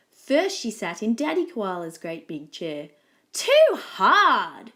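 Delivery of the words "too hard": loud, higher-pitched outburst; storybook scream. A narrator's reading. Goldilocks in Daddy Koala's chair, screaming complaint. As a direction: cry out in annoyance. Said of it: "she screamed".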